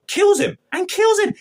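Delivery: real stunned cry at a sudden on-screen killing; shocked outburst. Real, not acted. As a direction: blurt it out in shock.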